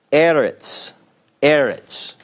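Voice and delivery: "eretz" stressed on the first syllable, falling away. Shown as a demonstration. Teacher introducing a Hebrew word, first syllable of "eretz" stressed. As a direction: say "eretz" with the accent on its opening E. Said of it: "is stressed not on the final syllable".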